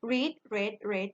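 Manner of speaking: slow drill of read: reed, red, red; three-form vowel drill. Shown as a demonstration. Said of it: "อันนี้สระอี อันนี้สระเอ อันนี้สระเอ".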